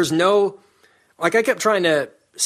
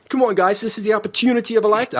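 faster, higher mock American accent; quick, pitched-up send-up of a fumbled accent; imitating botched American accent; 8 semitones higher, faster than usual. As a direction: rattle off a mimicked bad American accent. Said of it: "exactly like that".

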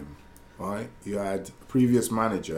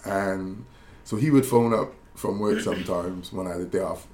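normal talking voice

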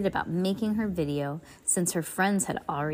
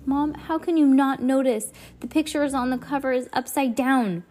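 exasperated protest; 6 semitones higher, 4 dB louder than usual. Acted. Heidi protests to Mom.

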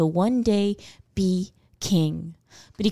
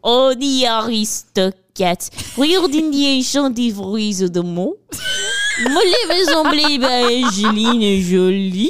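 fake French reading; 3 semitones higher, slower than usual. Friend reads the credits in mock French, hammed-up accent. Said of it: "read the rest to me en français".

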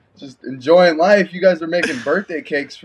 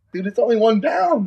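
exasperated retort; 9 semitones higher than usual. Retold or imitated. Retorting to his coaches.

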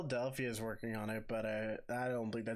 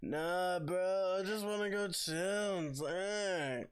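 put-on higher, drawn-out California surfer accent; Californian surfer bro; 6 semitones higher, slower than usual. Showing co-host how Californians talk, mock slow California accent. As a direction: stretch it out like a chilled Californian bro. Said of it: "they usually talk like this".

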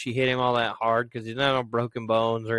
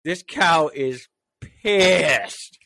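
slow emphatic; 3 semitones higher, 4 dB louder, much slower than usual. Real, not acted. Describing the furious heifer.

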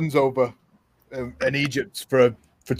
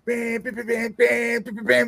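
high, quick beeping horn honks; playful horn-honk noises; imitated car horns; 8 semitones higher, 4 dB quieter than usual. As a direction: beep like a stream of impatient horns. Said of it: "That's all you hear constantly".

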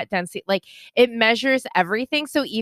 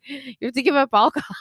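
higher-pitched, breaking into laughter; can't say without laughing; 4 semitones higher, faster than usual. Real, not acted. Telling co-host about giving up alcohol, laughing. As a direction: try to get it out through laughter. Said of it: "can't even say it without laughing".

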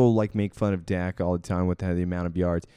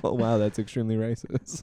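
normal talking voice

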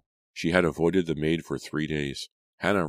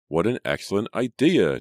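cheerful and eager, a bit louder; bright; 5 semitones higher, 5 dB louder than usual. A narrator's reading. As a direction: say it with bright enthusiasm. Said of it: "Julia said brightly".

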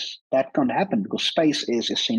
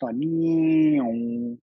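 whispered siren whoosh; 3 semitones higher than usual. Retold or imitated. Imitating a passing siren.

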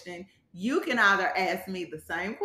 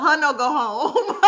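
laughing broken English joke; 5 semitones higher, 8 dB louder than usual. Real, not acted. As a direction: say it through laughter.

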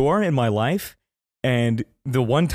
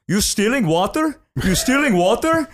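high, louder accusing question; accusing clerk; 10 semitones higher, 4 dB louder than usual. Retold or imitated. Retelling the clerk accusing him, high pitched. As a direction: mimic the clerk's suspicious challenge. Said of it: "He goes, goes".

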